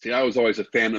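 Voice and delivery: ordinary delivery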